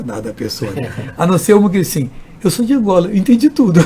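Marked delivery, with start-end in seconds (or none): 2.4-3.8 louder, higher, laughing; amused. Retold or imitated. Angolan woman telling the pastor she understood everything, laughing. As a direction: blurt it with a laugh.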